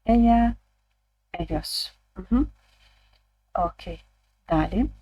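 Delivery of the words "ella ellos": Ella said as eya, a touch louder; showing the eya way to say ella; demo of a variant. Shown as a demonstration. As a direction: model ella with a y sound. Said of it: "обрати свій варіант".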